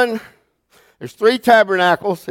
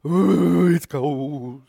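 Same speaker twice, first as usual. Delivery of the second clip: dramatic shiver; much slower than usual. Retold or imitated. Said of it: "I'm very dramatic".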